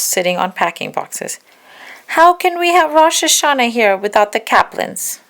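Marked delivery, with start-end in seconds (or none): 2.2-5.2 put-on high whiny complaint from a little girl; a child's high, loud complaint; complaining child. A narrator's reading. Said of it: "I complained".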